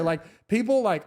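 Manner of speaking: usual speaking voice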